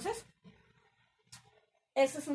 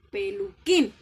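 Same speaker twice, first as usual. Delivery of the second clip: Peluquín with QUÍN louder than the rest; stress on last syllable of peluquín; 8 dB louder, slower than usual. Shown as a demonstration. Teacher showing which syllable sounds strongest, Peluquín stressed on QUÍN. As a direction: lean hard on QUÍN in Peluquín. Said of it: "la que suena más fuerte".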